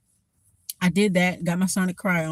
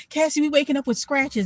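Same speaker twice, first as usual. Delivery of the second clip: higher-pitched imitation of complainers; mock complaint; 8 semitones higher than usual. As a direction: mimic them in a raised pitch. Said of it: "Don't come to me saying".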